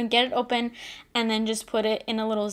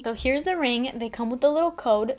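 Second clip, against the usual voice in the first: ordinary talk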